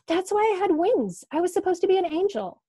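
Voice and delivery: stunned realization. Acted. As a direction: gasp it out as the truth hits. [name]'s realization, much higher.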